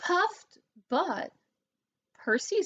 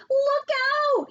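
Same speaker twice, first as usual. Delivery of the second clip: high, loud warning; cry out; 8 dB louder than usual. A narrator's reading. Pig warning of the wolf at the window, loud cry. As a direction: yelp it in sudden alarm. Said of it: "he cried".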